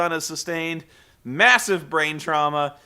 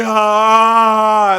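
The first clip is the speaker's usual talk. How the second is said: loud mock monster roar; hammed-up wrestler roar; Abyss yell; 5 semitones higher, 12 dB louder than usual. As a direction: bellow it out. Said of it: "Abyss is like".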